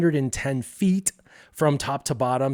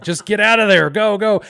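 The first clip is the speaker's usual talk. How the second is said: louder, higher, hurried escape order; urgent urging; 7 semitones higher, 7 dB louder than usual. Acted. His brain urging him to flee, urgent and raised. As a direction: push it out like a panicked inner alarm.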